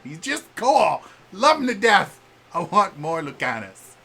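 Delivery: high, loud burst of fan love; gushing. Real, not acted. Fan raving about a favourite game companion, gushing. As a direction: gush loudly over your favourite.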